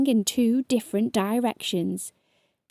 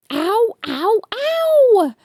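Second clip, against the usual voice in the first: high, louder howl of pain; 12 semitones higher, 6 dB louder, much slower than usual. A narrator's reading. Both bitten again, high howls. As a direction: howl them out. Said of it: "howled Spiky and Stripey".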